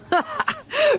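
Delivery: a sharp high scoffing laugh; scornful laugh. A narrator's reading. Manager scoffs at the nurse's question with a high laugh. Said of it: "He laughed".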